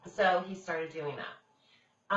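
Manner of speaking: usual speaking voice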